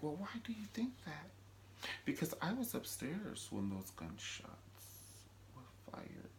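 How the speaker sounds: hushed conspiratorial whisper. Retold or imitated. Two characters plotting, a hushed whisper. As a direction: whisper it like a secret.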